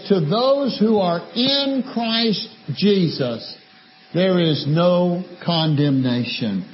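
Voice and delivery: slow, measured reading of the verse; a slow solemn quote; scripture reading. Shown as a demonstration. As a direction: read it out slowly like holy writ. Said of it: "But the Bible further says".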